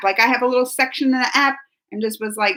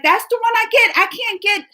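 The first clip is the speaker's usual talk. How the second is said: high-pitched indignant protest; mock upset; 10 semitones higher than usual. Complaining about getting Marie Antoinette, mock upset. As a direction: retell it as a playful high complaint. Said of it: "I was upset about it".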